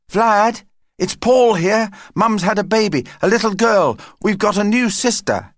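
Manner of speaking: high, eager phone announcement; excited child. Acted. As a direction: burst out with the happy news. Child phones news of new baby.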